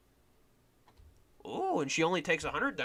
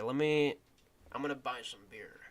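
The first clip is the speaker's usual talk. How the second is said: ordinary talk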